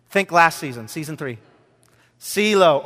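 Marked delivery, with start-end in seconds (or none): none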